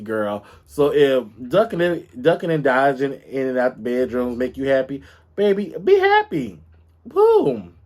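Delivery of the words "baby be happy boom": sassy exclamation. Real, not acted. Emphatic sign-off.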